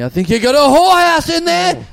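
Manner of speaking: high, loud hammed-up accusing yell; mock shout. Crazy man accusing the narrator, loud mock yell. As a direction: yell the accusation as a parting shot. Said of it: "he turns around and he goes".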